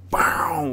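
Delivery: whispered explosion. Shown as a demonstration. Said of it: "like an explosion".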